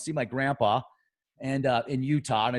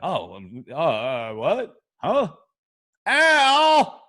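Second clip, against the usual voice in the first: hammed-up fumbling that jumps higher and ends in a loud yelp; mock bewildered stammer; 5 semitones higher, 6 dB louder, much slower than usual. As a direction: play dumb then cry out. Imagined fumbling reaction, then yelp.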